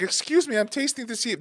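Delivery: high-pitched mock indignant wife; put-on high-pitched protest. Acting out wife's indignant protest.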